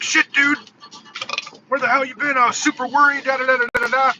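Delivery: worried scolding, retold; retold worry. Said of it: "I got a little emotional 'cause I was concerned".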